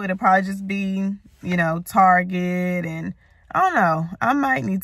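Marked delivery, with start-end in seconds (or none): none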